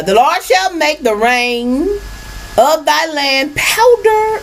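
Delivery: scripture reading. Acted. Reads scripture aloud.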